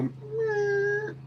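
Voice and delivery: very high, thin alien meow; tiny alien going meow. Retold or imitated. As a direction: squeak it like a tiny green man. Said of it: "the probes and go".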